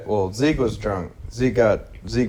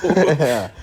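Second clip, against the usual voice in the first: normal talking voice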